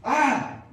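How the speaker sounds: loud, high shriek of a demon; sudden shrieking demon yell; demon cry. Acted. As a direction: let out a loud possessed cry. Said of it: "qué es lo que decía este espíritu inmundo".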